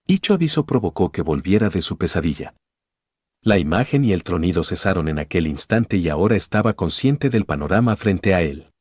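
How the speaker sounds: ordinary delivery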